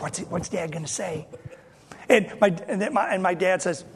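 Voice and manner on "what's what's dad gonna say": hushed whisper of siblings; nervous sibling whisper at the table. Retold or imitated. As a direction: breathe it under your breath.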